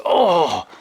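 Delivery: sudden loud, high cry of pain; pained yell. Retold or imitated. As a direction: imitate a yelp from a blow to the eye. Drummer hit in eye by stick.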